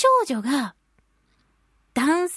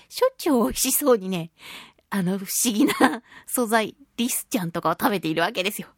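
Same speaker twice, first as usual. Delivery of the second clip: ordinary delivery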